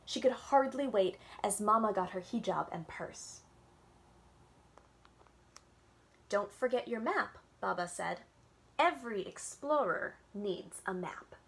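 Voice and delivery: gentle storytime read-aloud with little voices; reading to children. A narrator's reading. Librarian reading a picture book to kids on Zoom, storytime voice.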